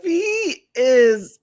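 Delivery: loud exasperated outburst; exclaiming loudly. Real, not acted. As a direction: burst out loud.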